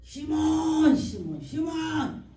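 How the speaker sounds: loud call, then slow repeats. Retold or imitated. God calling the preacher by name in a dream, loud slow calling.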